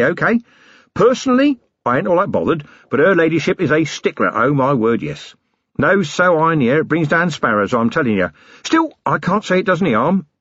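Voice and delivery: a blustering self-made man playing host; cheeky boss offering coffee, tea, something stronger; Harry King rough boss. Harry insists on title.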